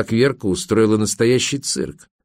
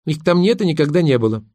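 higher, mildly scornful jibe; mocking smirk; 7 semitones higher than usual. A narrator's reading. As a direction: taunt him with a smirk. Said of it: "усмехнулась Верка". (с лёгкой насмешкой)

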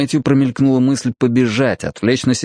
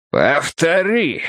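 slow, higher, grating threat; menacing grating voice; 6 semitones higher, much slower than usual. A narrator's reading. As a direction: grate it out slowly as a threat. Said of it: "угрожающе проскрежетал Фрост". (угрожающий скрежет, медленно)

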